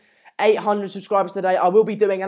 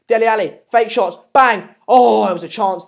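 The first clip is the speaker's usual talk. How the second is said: real burst of excitement at a chance, louder; excited match commentary; 3 semitones higher, 7 dB louder than usual. Real, not acted. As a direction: call the shot live, punching it out. Live call of a near chance.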